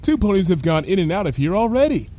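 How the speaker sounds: lightly voiced blithe character; Lyra, unworried. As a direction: wave away the worry. Lyra reassures friend.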